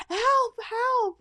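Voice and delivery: high, loud imitated panic; mock cries for help. Retelling a man attacked by a boar, mock panicked cries. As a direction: cry out like the panicked victim.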